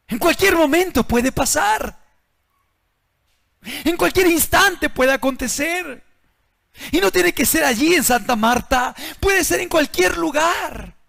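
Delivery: full-force sermon voice; fiery preaching. Acted. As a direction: drive the warning home from the pulpit.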